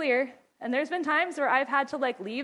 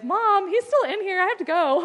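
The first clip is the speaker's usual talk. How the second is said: put-on higher, louder complaining child voice; imitating the kid; 8 semitones higher, 6 dB louder than usual. Child complaining to mom about being left in the bathroom, higher mock whine. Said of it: "someone's just like".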